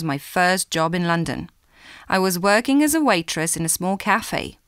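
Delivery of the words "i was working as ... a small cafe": ordinary talk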